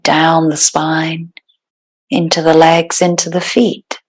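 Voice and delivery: calm, unhurried meditation guide with long pauses; guided meditation. Real, not acted. Yoga teacher settling the class into breathwork, guided meditation. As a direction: ease them into stillness, phrase by phrase.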